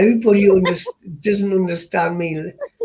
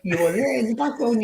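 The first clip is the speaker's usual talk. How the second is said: ordinary talk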